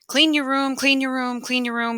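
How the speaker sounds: high-pitched mom repeating an order; mom giving a command. Acted. Mom telling you to clean up, high insistent command. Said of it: "She tells you in a command".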